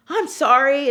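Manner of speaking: loud, high-pitched apology; retold frantic apology. Gail apologizing to boss after he sees blood, frantic. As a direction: blurt it out, acting flustered.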